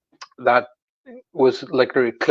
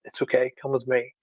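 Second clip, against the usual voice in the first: much softer, smiling and kindly; gentle reassurance; 11 dB quieter than usual. Retold or imitated. Gentleman in robes inviting a child along, softly reassuring. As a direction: quietly coax a child along. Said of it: "looked at me and smiled".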